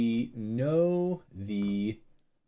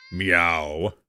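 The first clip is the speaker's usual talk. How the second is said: deeper meow; 4 semitones lower than usual. Acted. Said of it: "is its voice a little deeper".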